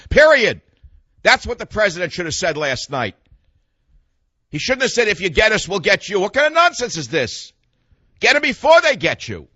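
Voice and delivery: loud, high-pitched political tirade; indignant rant. Real, not acted. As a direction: rail at them, punching the commands hard.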